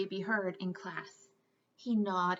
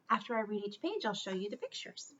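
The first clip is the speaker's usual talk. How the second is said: usual speaking voice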